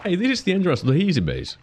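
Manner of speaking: high, fast, excited. Real, not acted. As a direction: blurt it out, thrilled. Excited discovery.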